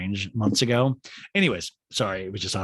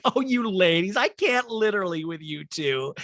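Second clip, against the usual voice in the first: higher-pitched mock exasperation; Kyle; 8 semitones higher, 4 dB louder than usual. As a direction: play the fed-up friend, pitched up. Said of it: "And Kyle's like".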